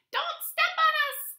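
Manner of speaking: very high, loud tiny-creature cry; crying out as ants. A narrator's reading. Ants scatter before Tortoise, crying out high. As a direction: squeal it like tiny ants underfoot. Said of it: "cried the ants".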